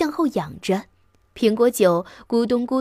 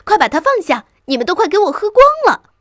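high, loud, frantic scolding; shrieking; 9 semitones higher, 8 dB louder, faster than usual. A narrator's reading. Mouse guarding its cider, shrieking. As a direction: squeal at them to put it down. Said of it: "老鼠尖叫道".

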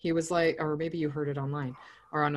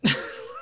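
short laugh. Real, not acted. Laughs after quote.

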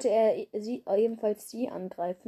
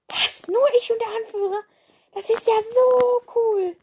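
put-on high, squeaky young cat voice, thrilled; excited little apprentice; 13 semitones higher, 5 dB louder than usual. The young apprentice thrilled to patrol with the leader. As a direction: gush like an eager kid.

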